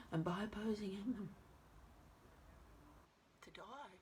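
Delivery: hushed opening, swelling as the thought grows; dramatic soliloquy. Acted. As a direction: think it aloud as Hamlet, with long pauses. Performs Hamlet's soliloquy.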